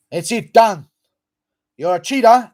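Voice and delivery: hammed-up accusing shout; mock yell. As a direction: yell it like calling someone out.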